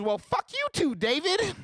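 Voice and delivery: high, shrieky woman voice; imitating angry woman. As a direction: screech back at him as a fed-up woman. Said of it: "Some woman comes outside and goes".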